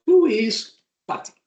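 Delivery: ordinary talk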